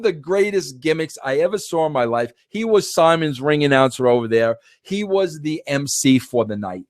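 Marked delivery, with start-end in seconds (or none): none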